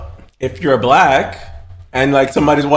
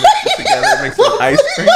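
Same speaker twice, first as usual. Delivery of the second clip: much higher, breaking into laughter; laughing falsetto through the joke; laughing high squeal. Pretend. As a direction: lose it mid-line, squealing. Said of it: "you could be like".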